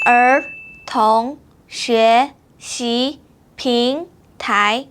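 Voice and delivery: 儿童学习平台 syllable by syllable; very slow model. Shown as a demonstration. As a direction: drag out each syllable. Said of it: "now it's your turn".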